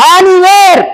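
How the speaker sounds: usual speaking voice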